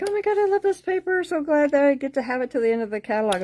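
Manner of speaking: high, fast and thrilled; gushing excitement. Retold or imitated. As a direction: gush over the paper like you just discovered it. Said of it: "I'm like".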